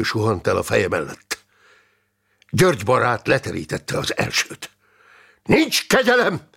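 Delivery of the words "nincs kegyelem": high, fierce exclamation; shouted war cry. A narrator's reading. Fighter caught up in his friend's zeal, shouted war cry. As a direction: cry it out with battle fervour. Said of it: "kiáltott fel Lack Fipalkó".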